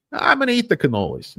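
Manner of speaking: high, playful whining actor; mock actor voice. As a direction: tease like a greedy co-star. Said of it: "I said".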